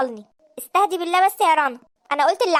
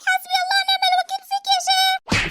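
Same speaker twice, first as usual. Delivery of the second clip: high, shrieked curse at her husband; desperate scream; 13 semitones higher than usual. Acted. Wife screams while being grabbed. (صرخة يائسة عالية)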